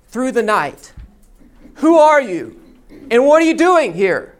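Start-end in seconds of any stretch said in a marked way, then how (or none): 1.7-4.3 loud, higher challenge called into the dark; guard shouting. Retold or imitated. Roman guard challenges rabbi in the dark, loud shout. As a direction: bark the question out as a night sentry. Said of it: "The voice shouts again".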